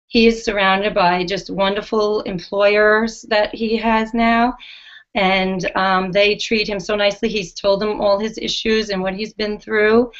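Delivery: ordinary talk, nothing marked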